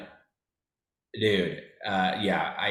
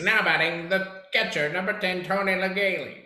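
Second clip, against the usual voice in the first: higher, booming ballpark announcer call; stadium announcer; 9 semitones higher than usual. Retold or imitated. Kid in the backyard playing stadium announcer. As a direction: announce the batter to the crowd. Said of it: "it's like".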